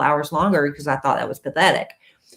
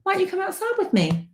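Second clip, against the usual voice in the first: high, fast whiny complaint; 13 semitones higher, 5 dB quieter, faster than usual. Real, not acted. Complaining to husband, mock hurt.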